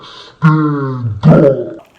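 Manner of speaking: low, loud, drawn-out bellow. Retold or imitated. Tiny girl orders student up, low mock bellow. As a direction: imitate her with a deep, overblown roar. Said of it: "like, raise her voice".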